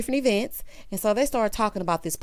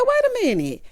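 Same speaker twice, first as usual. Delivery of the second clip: startled interruption; 5 dB louder than usual. Retold or imitated. Lisa cuts off the toast.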